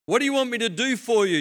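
high, loud, projected question. Acted. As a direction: call the question out. Jesus asks blind man.

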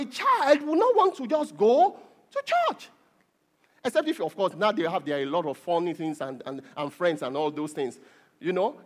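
ordinary talk, nothing marked

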